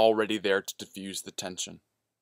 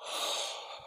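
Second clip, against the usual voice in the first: soft, voiceless breath out; quiet breath in the dark; long exhale; 11 dB quieter than usual. A narrator's reading. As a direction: let out a slow, hushed sigh. Breath before the lurking voice.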